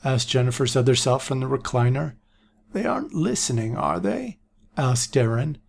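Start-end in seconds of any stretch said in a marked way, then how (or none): 2.7-4.4 put-on higher, softer old man; hushed old seer. A narrator's reading. Aaron to Jennifer, softer old voice. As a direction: check no one overhears.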